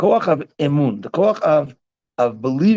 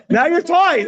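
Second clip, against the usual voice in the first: playful retold announcement; 7 dB louder, slower than usual. Teasing retold line to daughter, laughter.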